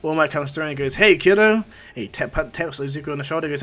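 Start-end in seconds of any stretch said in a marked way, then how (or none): 0.9-1.6 loud, high, booming hello; hearty greeting. Acted. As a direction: hail the kid with big energy. All Might greets Izuku heartily.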